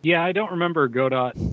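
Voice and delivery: ordinary delivery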